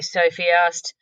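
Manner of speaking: ordinary delivery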